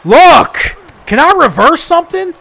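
high, pleading outburst; exasperated protest. Real, not acted. Player begging the game for one reversal, exasperated. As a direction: cry out in frustration, pitch jumping up.

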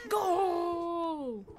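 long, high, loud shout; goal cheer. Real, not acted. Player scoring in soccer with the kitty, drawn-out goal shout. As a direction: stretch it out like a football commentator.

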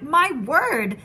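marvelling outburst; astonished exclamation. A narrator's reading. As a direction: exclaim it as an amazed onlooker. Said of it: "they shouted".

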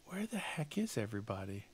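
hushed puzzled whisper. Real, not acted. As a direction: breathe it while hiding. Puzzled whisper while sneaking.

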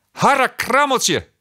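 cartoonish child exclamation; calling Hotse. A narrator's reading. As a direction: stretch it out like a dismayed little boy. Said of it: "riep Hotse".

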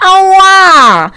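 loud high yelp of pain, like a sudden hit; wild yelp of pain. Acted. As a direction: yell out as if struck on the head. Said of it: "Usłyszał dziki wrzask". (głośny, wysoki okrzyk bólu)

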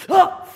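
a sudden yelp of shock; startled cry. Acted. Woman hit in the park, startled cry.

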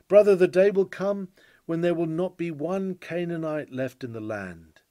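normal talking voice

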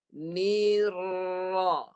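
"Nira" drawn out with a long, thick rolled r; model recitation. Shown as a demonstration. Teacher shows three sounds for student to copy, drawn-out recitation. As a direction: slowly link "Nira", holding each of its sounds. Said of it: "Dengar saya baca".

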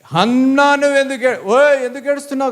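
high-pitched mock scolding; harsh scolding husband. Shown as a demonstration. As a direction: call out at her like an exasperated husband. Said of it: "కాదు, అక్కడ బాగా అన్నాడు". (కసురుకునే భర్తలా)

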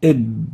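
إب with the lips closed and stopped on the b; sukun on ba. Shown as a demonstration. A teacher demonstrating a silent ba to students. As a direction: stop on the b in إب without releasing. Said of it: "حرف باء ساكن".

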